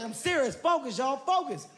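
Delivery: high, pressing call to focus; the teammate in back warning them; urgent warning. Retold or imitated. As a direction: snap the crew to attention. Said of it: "So Lance like".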